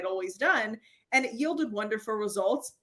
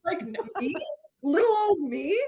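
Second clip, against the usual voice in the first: feigned innocence; 6 semitones higher than usual. Retold or imitated. Pretending calm to partner.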